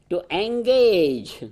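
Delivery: engage drawn out slowly and leaned on; slow, weighty "engage" with a falling close; stress on engage. Shown as a demonstration. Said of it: "I'm emphasizing on the word engage".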